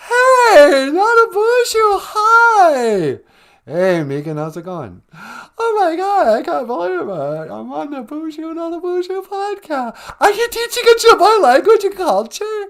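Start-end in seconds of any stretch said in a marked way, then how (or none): 0.1-3.2 much higher, louder, gushing caricature of Megan; mock Megan voice. Host plays Megan on the phone, high gushing squeal. Megan voice greets Nana Buju. 5.1-12.6 much higher, louder, gushing caricature of Megan; mock Megan voice. Host plays Megan on the phone, high gushing squeal.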